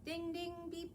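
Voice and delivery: ordinary talk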